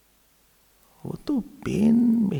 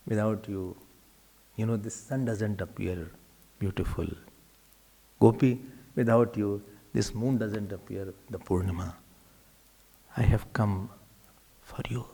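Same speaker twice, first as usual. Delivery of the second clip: tender hush; 13 semitones lower, 9 dB quieter than usual. Retold or imitated. Krishna's tender words to gopi.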